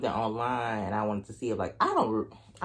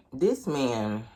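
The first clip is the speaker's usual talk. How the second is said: ordinary talk, nothing marked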